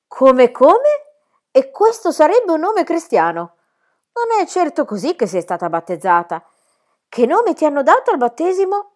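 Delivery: high, loud, indignant governess voice; a strict lady scandalised by the name; stern governess. Acted. Rottenmeier interrogates the child about her name, high and indignant.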